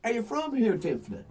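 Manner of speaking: mock-friendly stage banter. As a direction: fake sweetness to set her up. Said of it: "So I go".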